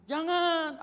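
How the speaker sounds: loud, high, drawn-out cry; high-pitched pulpit shout; shouted warning. Real, not acted. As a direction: yell it as a warning.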